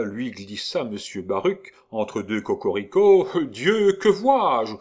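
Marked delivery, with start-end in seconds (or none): 3.5-4.7 mock theatrical dismay. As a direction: overblown stage exclamation. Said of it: "On dit aussi dans ces cas-là".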